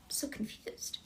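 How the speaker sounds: whispered, puzzled aside. Real, not acted. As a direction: whisper it to yourself in confusion. Puzzled aside about fan's request.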